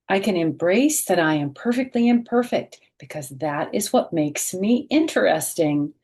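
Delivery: bright, expressive picture-book read-aloud; reading to children. A narrator's reading. Teacher reading a picture book aloud to children, expressive.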